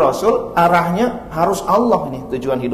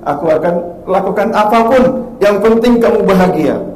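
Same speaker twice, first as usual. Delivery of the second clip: higher, louder devoted husband; eager husband; 5 semitones higher, 5 dB louder than usual. Retold or imitated. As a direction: promise anything, eagerly. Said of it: "Kata suaminya". (suami bersemangat, lebih keras)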